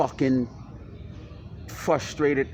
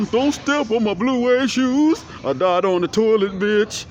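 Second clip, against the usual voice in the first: high, loud mock [name] voice; cartoonish [name] bit; [name] impression; 8 semitones higher, 6 dB louder than usual. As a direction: ham up a squeaky [name]. Voicing [name] toy.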